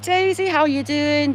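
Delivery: cheery greeting. Pretend. Greeting a grave like a friend.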